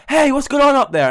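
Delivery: high, loud call up the stairs; a mum hearing the commotion, calling up loudly; calling upstairs. Retold or imitated. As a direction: shout up to the kids from below.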